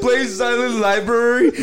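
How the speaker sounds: normal talking voice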